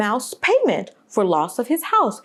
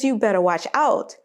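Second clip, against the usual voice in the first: ordinary delivery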